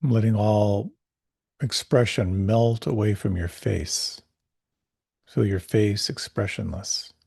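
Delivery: calm, unhurried meditation voice; guided meditation. Acted. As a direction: guide the listener gently inward.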